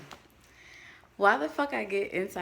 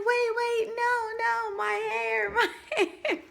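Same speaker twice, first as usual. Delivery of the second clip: much higher, louder frantic cry breaking into a laugh; panicked shriek; 3 dB louder than usual. Retold or imitated. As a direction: shriek at the plow crew to stop. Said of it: "I was like".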